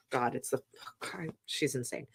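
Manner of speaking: soft unvoiced whisper; whispered aside. Real, not acted. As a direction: breathe it out as a secret aside. Whispered aside about toddler.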